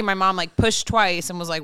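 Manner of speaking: ordinary talk, nothing marked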